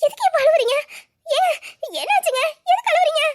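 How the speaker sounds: high, anxious questioning of a crying husband; worried. Acted. Wife finds husband crying. (கவலையுடன் உயர்ந்த குரலில்)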